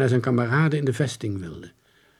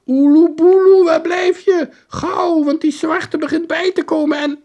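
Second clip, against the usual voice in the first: put-on high, loud, urgent boy's cry; boy calling; 11 dB louder than usual. Boy on pirate TV calling his friend, high urgent cry. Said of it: "Maar nu riep hij".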